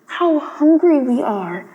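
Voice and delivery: put-on slow, weary little boys' voices; slow plaintive voice of starving children; hungry boys lamenting. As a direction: sigh it out, worn down. Starving boys under the bridge.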